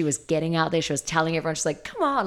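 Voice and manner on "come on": teasing push. Retold or imitated. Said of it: "like, giving them grief".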